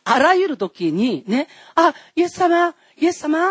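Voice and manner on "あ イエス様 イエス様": put-on a child eagerly calling to Jesus. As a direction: call out like a longing child.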